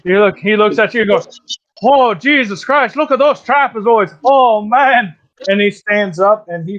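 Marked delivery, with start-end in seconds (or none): 1.8-5.1 higher-pitched gushing admiration; awed bear. Acted. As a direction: gush over a fellow strongman's muscles. Bear admires flexing in game.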